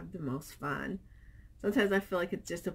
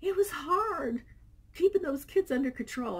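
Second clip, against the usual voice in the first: mock weary husband; 9 semitones higher, 4 dB louder than usual. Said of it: "he was like".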